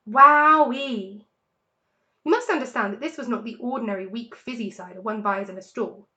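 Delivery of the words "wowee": amazed. A narrator's reading. Fox after fiery cider.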